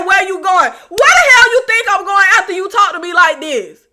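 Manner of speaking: husband snapping back at his wife, mock angry; imitating angry husband. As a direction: throw his line back, offended. Acting the husband's angry retort.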